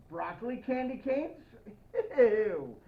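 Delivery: usual speaking voice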